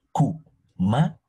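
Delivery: kumara slowly, each consonant spelled out clearly; drawn-out kumara. Shown as a demonstration. Teacher slowly sounding out kumara to compare it with camote. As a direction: stretch out kumara so the consonants stand out. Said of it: "Compara las consonantes".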